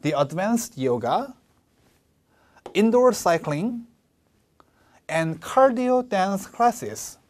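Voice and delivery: slow list, rise, rise, then fall; "yoga" and "cycling" rise, "classes" falls; slow, with pauses between the items; list intonation. Shown as a demonstration. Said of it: "올리고 올리고 내리고".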